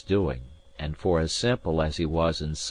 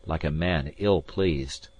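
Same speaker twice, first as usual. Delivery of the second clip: ordinary delivery, nothing marked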